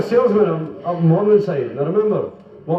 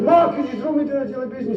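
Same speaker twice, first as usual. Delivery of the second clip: mock child calling up; 8 semitones higher, faster than usual. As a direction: call up to Ma as a child, hammed up. Child calls up to Ma.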